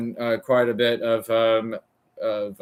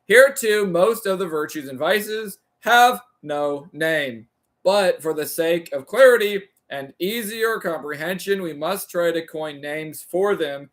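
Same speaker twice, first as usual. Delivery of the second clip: raised, deliberate reading of a philosophy text; formal reading aloud; 5 semitones higher than usual. Acted. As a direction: read it like a careful lecture recitation. Reads Aristotle translation aloud.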